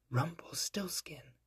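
slow, soft whisper to herself. A narrator's reading. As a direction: breathe the name slowly. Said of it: "said the queen".

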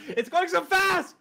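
real panic at the speed; frustrated yell. Real, not acted. Gamer yelling at scrolling credits, exasperated shout. Text scrolling too fast.

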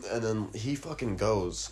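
ordinary talk, nothing marked

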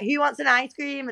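high-pitched imitation of a boy's call-out; show-off kid. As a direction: call to all the kids like a big spender. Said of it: "He'd literally be like".

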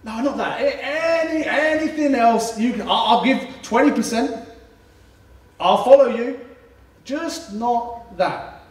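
high, pleading, with a laugh; high, desperate, laughing bargaining; panicked bargaining. Retold or imitated. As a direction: beg to be let off anything but that. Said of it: "being like".

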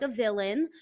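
ordinary delivery

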